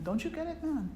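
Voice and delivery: put-on lilting, high-pitched Hindi accent. Friend chiding the man who wants out, imitated Hindi accent. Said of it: "in his Hindi accent".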